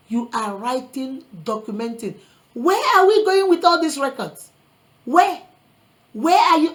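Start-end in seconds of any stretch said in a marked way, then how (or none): 2.5-6.7 raised voice, pressing the question; loud rhetorical where, demanding an answer; louder preaching challenge. Real, not acted. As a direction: push it hard at the congregation. Preacher challenging record keeping.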